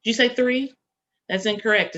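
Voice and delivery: usual speaking voice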